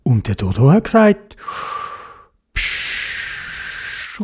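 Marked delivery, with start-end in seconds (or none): 2.5-4.2 snorkel hiss. A narrator's reading. Snorkel breath sound.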